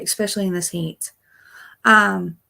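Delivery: usual speaking voice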